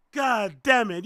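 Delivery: usual speaking voice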